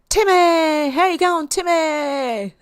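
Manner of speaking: loud, high shout of hello; calling out greeting. Real, not acted. Host greets Timmy arriving in chat, high loud call. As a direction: holler the name up high, delighted to greet them.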